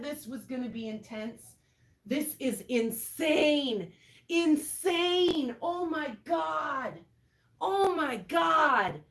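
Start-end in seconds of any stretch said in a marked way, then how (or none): 2.0-9.0 loud, drawn-out disbelief; stunned and belting it out slowly; awestruck exclaiming. Real, not acted. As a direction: stretch each exclamation out, loud and amazed. Awestruck by an intense storm.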